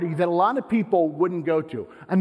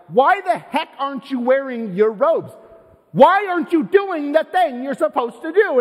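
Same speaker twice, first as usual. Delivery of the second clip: higher and louder, incredulous demand; indignant challenge; 9 semitones higher, 6 dB louder than usual. Retold or imitated. Party crowd demanding why the outsider won't wear robes, rising indignation. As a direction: call him out in front of everyone. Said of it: "They're like".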